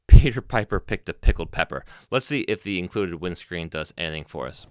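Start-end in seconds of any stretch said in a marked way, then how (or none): 0.1-1.8 popping P sounds right on the capsule; close-mic plosives. Shown as a demonstration. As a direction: hit every P hard into the mic. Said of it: "you'll hear every plosive right now".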